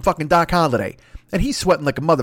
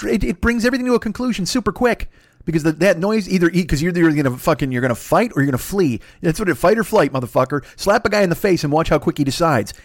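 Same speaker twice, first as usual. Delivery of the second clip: ordinary delivery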